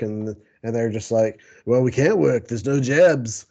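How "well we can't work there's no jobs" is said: put-on British accent. Explaining to Boba Fett why they can't work, mock British accent. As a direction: put on a cheeky British accent for the broke mods. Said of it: "that's my British accent".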